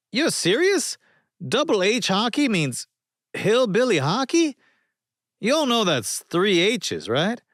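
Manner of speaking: mock outrage at the spelling; incredulous. A narrator's reading. Sonny to his friends, high incredulous disbelief.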